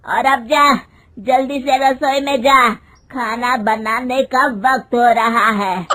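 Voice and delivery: loud, scolding dismissal; angry order. A narrator's reading. Mother-in-law sending daughter-in-law to the kitchen, angry. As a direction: order her off loudly and crossly. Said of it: "उसकी सास गुस्से में कहती है".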